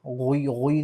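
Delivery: गोई as full, rounded ghwee; wrong heavy गोई. Shown as a demonstration. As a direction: fill the mouth on गोई. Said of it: "इसको हम पूर्ण पढ़ेंगे". (गोई को पुर (भारी) पढ़ना)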